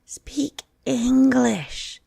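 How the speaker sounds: high squeaky bird voice, drawn out; a small bird snapping back; eaglet's high, slow demand. Acted. The eaglet rebukes the dodo in a high bird voice. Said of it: "said the eaglet".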